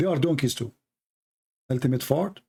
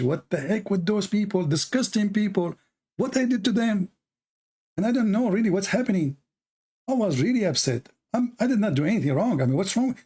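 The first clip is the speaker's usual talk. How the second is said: raised, wounded outrage; what did I do to them, higher; indignant retelling; 6 semitones higher than usual. Retold or imitated. Telling viewers about being insulted online, indignant. Upset recalling chat insults.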